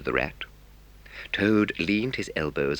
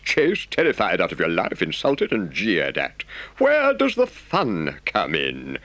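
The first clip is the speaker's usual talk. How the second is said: lightly voiced stern Rat, lecturing; a hint of a scolding water rat; mild reproachful lecture; 7 semitones higher, 6 dB louder, slower than usual.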